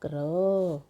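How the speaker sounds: क्र as one long blended syllable; blended sound drawn out. Shown as a demonstration. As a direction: join क and र into क्र and hold the vowel. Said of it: "ये क्या बन गया हमारा". (क्र को जोड़कर लंबा खींचते हुए)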